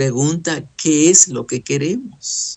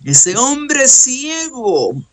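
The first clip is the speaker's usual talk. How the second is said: clamouring; 7 semitones higher, slower than usual. Acted. Preacher enacts the clamour himself.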